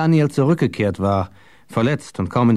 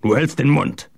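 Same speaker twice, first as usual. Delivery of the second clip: growled rebuke, higher than narration; 5 semitones higher than usual. A narrator's reading. Johann silencing Daniel, growling. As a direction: growl the order to silence him. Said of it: "knurrte Johann".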